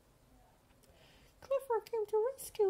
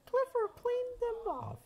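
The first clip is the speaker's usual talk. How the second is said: usual speaking voice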